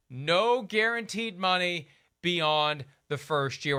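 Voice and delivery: usual speaking voice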